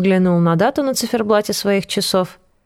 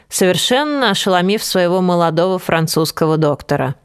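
plain reading voice

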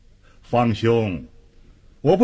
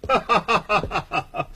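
long, high ha-ha-ha laugh; hearty laugh; 5 semitones higher, 4 dB quieter than usual. Acted. Official greeting his imprisoned old classmate, hearty laugh. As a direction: laugh heartily in evenly spaced bursts.

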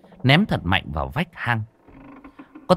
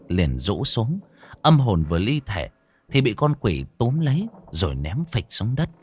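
ordinary delivery

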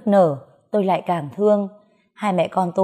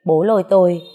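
usual speaking voice

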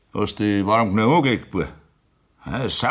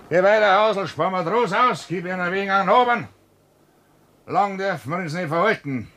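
calling the stable hand; 9 semitones higher than usual. Acted.

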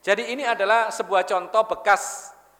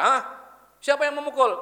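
higher, indignant mother demanding who did it; angry mother; 7 semitones higher than usual. Retold or imitated. Mother hears her child was hit, angry questioning. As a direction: snap the question like an outraged mom. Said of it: "Ibu langsung marah".

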